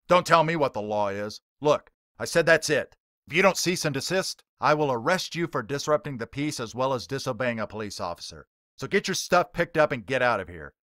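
stern officer. Acted. Police officer ordering the preacher out, loud and stern. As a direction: lay down the law, no arguing. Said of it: "the officer enforces".

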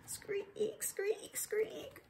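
high breathy squeak noises; whispered squeaks. Acted. Reader making the tired crank squeak. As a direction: squeak like a straining pulley.

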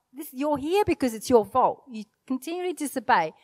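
ordinary talk, nothing marked

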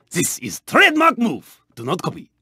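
ordinary delivery

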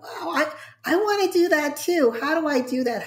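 high-pitched wowed onlooker; imitated eager admirer. Voicing people around contagious celebration, eagerly impressed. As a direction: lift it up with keen wanting.

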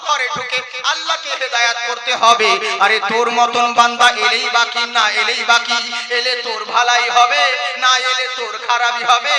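loud, fiery sermon rebuttal; preaching at full force. Acted. Preacher rebuking the guardian before the crowd, fiery and loud. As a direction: thunder the answer at the excuse.

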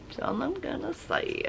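very soft, high little echo; playful hush. Pretend. Vlogger playfully echoing herself to viewers, hushed high voice. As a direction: repeat it tiny and high, barely audible.

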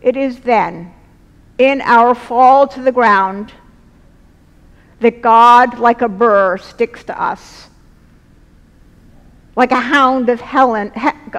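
ordinary delivery

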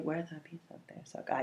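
a soft airy whisper of the line. Shown as a demonstration. Teacher repeating the song line to students in a hush. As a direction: breathe "Where the bee sucks, there suck I" almost silently. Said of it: "how many, um, syllables there are".

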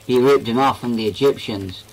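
ordinary delivery

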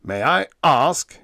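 slow, loud, pompous demand; ponderous, bridling formality; stuffy. A narrator's reading. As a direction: ask it stuffily, offended. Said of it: "he said stuffily".

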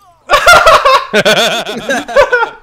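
loud, high burst of real laughter. Real, not acted. Friend laughing loudly at a clueless enemy soldier.

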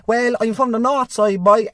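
a hint of a drawn-out northside Cork local; norrie. Norrie answering a question put to him, lightly voiced. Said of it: "they'll respond with".

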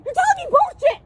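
high, loud angry outburst; real shout of outrage; furious yelling. Real, not acted. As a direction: explode at the officer, high and loud. Shouting match with police officer.

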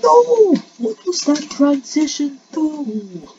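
very high, loud cry of disbelief; dismayed scream. Real, not acted. Player thrown by the transition, high dismayed scream. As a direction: scream no, then wail the complaint slowly. Outcry at failing in game.

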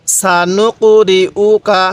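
সানুকরিউকা slow, every syllable clear; slow recitation. Shown as a demonstration. Teacher demonstrating the word, slow. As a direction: say সানুকরিউকা slowly. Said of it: "কিভাবে পড়ব?".

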